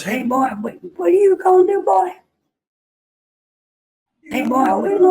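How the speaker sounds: high, hollering cartoon drill-boss voice; Foghorn Leghorn. Petty officer hollering at the new recruits, high cartoon yell. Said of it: "going around yelling at everyone".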